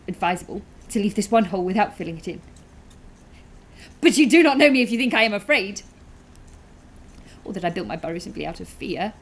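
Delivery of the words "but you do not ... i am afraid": louder, higher indignant protest; proud, forceful denial. Acted. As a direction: bristle loudly at being thought afraid. Burrower indignantly denies being afraid.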